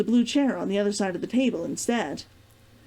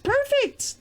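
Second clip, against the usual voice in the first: much higher, bright sing-song squeak; playful squeal. Pretend. Checking the mic again, a jokey high squeal.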